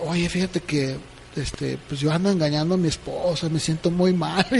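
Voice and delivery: a quiet, guilty admission; ashamed quiet confession. Retold or imitated. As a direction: confide it softly to a friend at work. Coworker confessing his infidelity.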